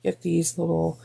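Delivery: ordinary talk, nothing marked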